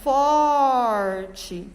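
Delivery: "forte" loud, with a long first syllable; teacher's emphatic, stretched "forte". Shown as a demonstration. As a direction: lean hard and slowly on the for of "forte". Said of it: "é a sílaba forte".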